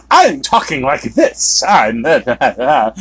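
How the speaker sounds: hammed-up frazzled actress voice, pitch lurching up and down; Cate Blanchett impression. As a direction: mimic an actress's mannered, swooping delivery. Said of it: "She does that whole".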